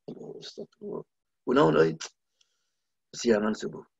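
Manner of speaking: hushed astagfirullah, then muted dismay; mild mock regret. Preacher acting someone who overslept dawn prayer, mildly regretful. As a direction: murmur it like a rueful oversleeper. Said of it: "merasa menyesal".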